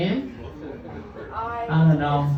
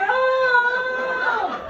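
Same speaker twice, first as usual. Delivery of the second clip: long, high-pitched hammed-up "oh"; mock revived gasp. Patient with oxygen blowing in the face, hammed-up revival cry. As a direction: wail high as if jolted awake. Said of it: "They're just like".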